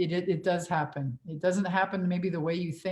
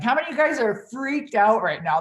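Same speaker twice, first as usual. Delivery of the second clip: teasing question; 5 semitones higher, 6 dB louder than usual. Retold or imitated. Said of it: "I'm like".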